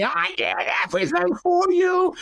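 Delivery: sudden high furious shriek; stepmom screaming. Retold or imitated. Stepmom shrieks at stepson as she slams him, furious high scream. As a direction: hurl it at him while shoving him hard.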